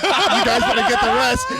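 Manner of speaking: loud, high retold taunt; mock shout. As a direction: holler it at the others like a joke. Retold taunt after biting the banana.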